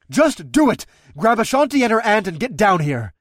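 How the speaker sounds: loud, high urgent order; insistent shout. Acted. Rider insisting to her dragon, shouting. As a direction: cut off the argument.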